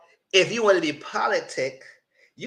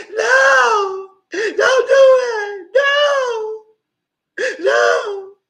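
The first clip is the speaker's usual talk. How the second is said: much higher, louder, slow hammed-up sobbing no; mock crying wail; 9 dB louder, much slower than usual. Imitating Hannah begging through tears, hammed-up wail. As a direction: wail it like a soap opera heroine. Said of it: "She said".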